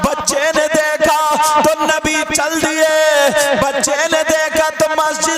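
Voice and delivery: loud, chanted sermon storytelling; preaching at full force. Acted. Preacher to the congregation, telling the Prophet's story, fervent chanting preaching. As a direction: preach it with swelling fervour, half sung. Impassioned sermon over music.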